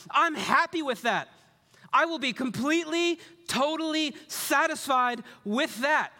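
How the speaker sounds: high, emphatic declaration to God; voicing the psalmist with full conviction; psalmist's declaration. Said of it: "This voice here is that good. He's saying".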